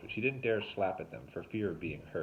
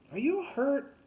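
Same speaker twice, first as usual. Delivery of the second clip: high, raised call of concern; 5 dB louder, slower than usual. A narrator's reading. Tuna calls toward Derek in the woods, calling out. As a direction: call up to someone out of reach. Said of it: "Tuna called".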